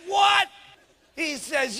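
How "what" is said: loud, high outraged "What?"; husband yells at his wife about the cost; angry husband shout. Retold or imitated. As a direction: bark it in disbelief.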